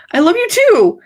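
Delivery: real laughter through the words; laughing. Real, not acted. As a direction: laugh it out, high and bright with glee. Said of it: "I'm crying just from laughing".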